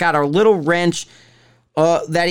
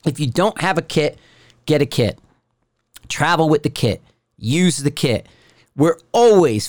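ordinary talk

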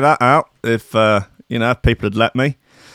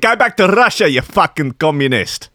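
high-pitched opening in mock South African; South African accent; 4 semitones higher than usual. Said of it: "South African".

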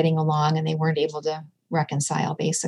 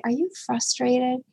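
soft, higher, caring check-in; gentle question; 6 semitones higher, 5 dB quieter than usual. Retold or imitated. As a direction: ask softly, like a caring mom. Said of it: "I'll just say".

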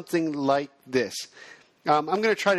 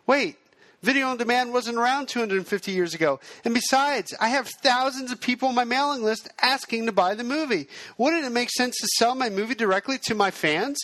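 higher-pitched, amateurishly acted objection; new filmmaker; 7 semitones higher than usual. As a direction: play a newcomer challenging a sales pitch, pitched up. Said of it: "I'll also play the new filmmaker".

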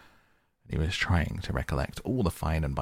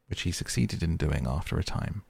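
plain reading voice

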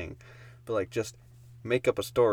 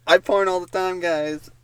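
mock bragging voice; 8 semitones higher, 8 dB louder than usual. Imitating friend again.

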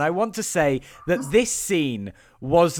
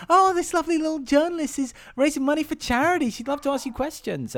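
much higher, sugary society-wife voice; gushing wife; 11 semitones higher than usual. Retold or imitated. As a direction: gush it out like a proud hostess. Wife introduces journalist to husband.

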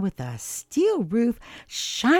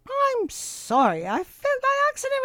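small, polite apology in a higher mouse voice; a lightly voiced, polite mouse; polite little mouse. A narrator's reading. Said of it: "replied Cooper politely".

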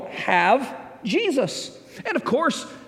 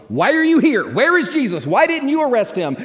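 higher, louder scolding questions; angry priests demanding; 6 semitones higher, 4 dB louder than usual. Retold or imitated. Priests confronting officers who failed to arrest Jesus, angry. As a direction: rebuke the officers for coming back empty.

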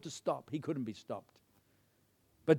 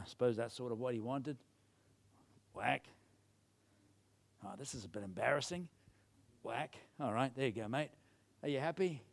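low, soft half-hearted compliance; sheepish; 5 semitones lower, 10 dB quieter than usual. Acted. King feebly whacking arrows for the prophet, sheepish. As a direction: go along with it, quietly embarrassed and reluctant. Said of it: "maybe he's feeling a bit sheepish".

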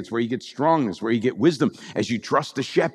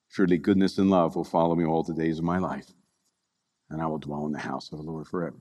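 prayerful reading; 7 semitones lower than usual. Acted. Pastor reading the psalm as Jesus praying, hushed and prayerful. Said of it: "read the psalm with Jesus praying this".

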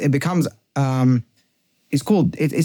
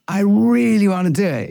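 higher whiny wanting; hammed-up temptation voice, pitched up; craving inner voice; 7 semitones higher, 3 dB louder than usual. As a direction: whine like the urge inside you. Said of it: "the negative drive speak in the first person".

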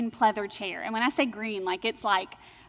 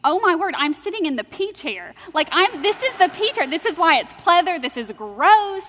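excited disgust; 6 semitones higher, 7 dB louder than usual. Retold or imitated. Retelling panicked thought in chair.

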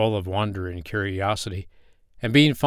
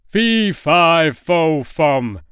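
booming giant's bellow, higher and louder; voice like thunder; 6 semitones higher, 8 dB louder, much slower than usual. Acted. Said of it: "in a voice like thunder".

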